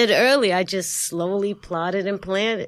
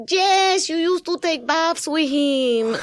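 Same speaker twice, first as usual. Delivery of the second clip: put-on much higher, slow Puerto Rican accent; Puerto Rican aunt; 9 semitones higher, slower than usual. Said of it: "the Puerto Rican accent of your aunt".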